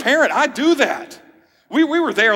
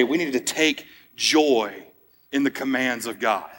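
earnest, drawn out; 7 semitones lower, 4 dB quieter than usual. Real, not acted. Pastor's earnest application to congregation.

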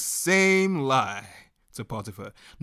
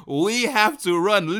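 normal talking voice

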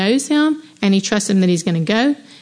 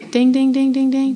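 higher, repeated "ding, ding, ding"; imitated bell; 4 semitones higher than usual. Shown as a demonstration. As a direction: ring out like an alert bell. Imitated alert bell before a question.

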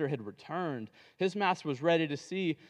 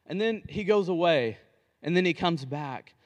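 usual speaking voice

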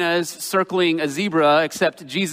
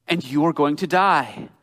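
earnest plea. Acted. Pastor presses sinners to face God.

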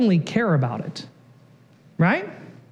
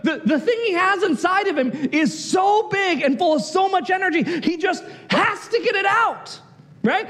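ordinary talk, nothing marked

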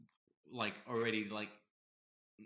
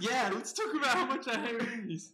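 mock agreement; 12 semitones higher, 8 dB louder than usual. Sarcastic agreement.

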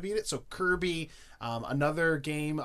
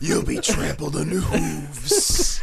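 horror trailer voice; 5 dB louder, slower than usual. Acted. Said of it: "I got one".

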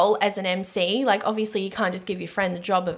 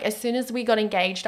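usual speaking voice

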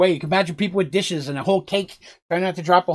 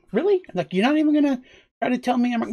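incredulous; 8 semitones higher, faster than usual. Acted. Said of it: "Petal presses".

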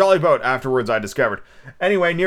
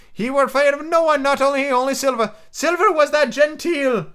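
put-on high, excitable old castaway; squeaky marooned sailor, eager and odd; lightly cracked high pirate voice; Ben Gunn voice; 11 semitones higher than usual. Ben Gunn's voice in the story.

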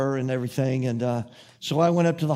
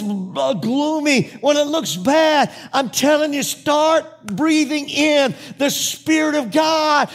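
high, loud, ringing proclamation; full-force preaching; 10 semitones higher, 6 dB louder, slower than usual. Real, not acted. Preacher proclaims eternal hope to congregation, full-force preaching. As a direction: preach it at the top of your voice.

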